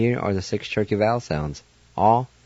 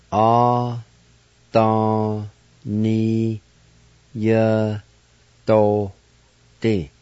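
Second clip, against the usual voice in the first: Adaniyatote stretched out very slowly; teacher modelling slowly; very slow demo; much slower than usual. Shown as a demonstration. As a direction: draw Adaniyatote out syllable by syllable. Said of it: "And now pronounce these words".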